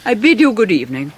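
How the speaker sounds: loud, high, icy goodbye; cold dismissal. Acted. Proud mother dismissing David with loud icy finality. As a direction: cut the meeting off sharply.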